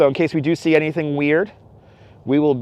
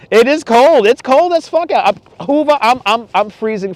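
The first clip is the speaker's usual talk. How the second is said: complaining about the cold; 7 semitones higher, 3 dB louder than usual. Real, not acted. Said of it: "I am freezing".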